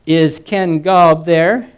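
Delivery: loud, slow operator cutting in; impatient operator. A narrator's reading. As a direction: cut in as the operator, drawing it out loudly. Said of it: "the operator interrupted".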